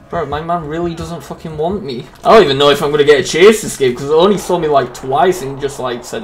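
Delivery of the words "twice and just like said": ordinary delivery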